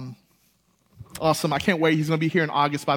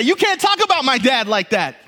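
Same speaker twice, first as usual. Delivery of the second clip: angry retort; 8 semitones higher, 7 dB louder than usual. Retold or imitated. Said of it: "I wanted to say".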